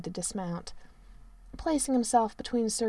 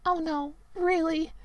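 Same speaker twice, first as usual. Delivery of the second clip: mock selfless; 8 semitones higher than usual. A narrator's reading. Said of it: "Merlin said in a selfless tone".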